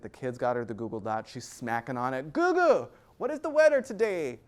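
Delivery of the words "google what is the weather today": loud, high Italian grandma impression; thick Italian accent. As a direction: bellow at the gadget like an Italian grandmother. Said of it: "Thick Italian accent".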